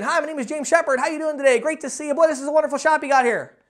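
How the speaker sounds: loud, high, pushy cheer; overly peppy greeting. Shown as a demonstration. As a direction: bounce in far too hard for an old man. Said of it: "I'm not going in saying".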